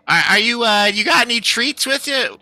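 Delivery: high, eager guard voice asking for treats. Acted. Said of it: "as he starts to be like".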